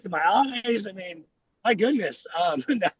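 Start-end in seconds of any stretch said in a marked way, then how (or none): none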